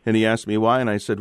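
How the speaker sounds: ordinary talk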